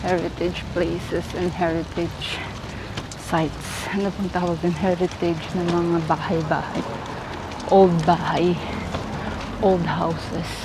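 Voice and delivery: breathless from walking; panting between words; out of breath. Real, not acted. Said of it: "Hinihingal na ako".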